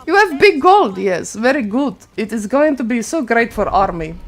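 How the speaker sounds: mock Russian accent for the Byzantine player; a playful Russian accent. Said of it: "I kinda wish I could do the Russian accent".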